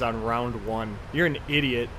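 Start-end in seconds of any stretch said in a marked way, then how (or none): none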